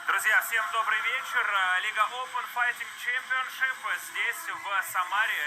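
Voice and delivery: loud, energetic broadcast intro; hyped commentator opening. Acted. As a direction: announce it big to the arena.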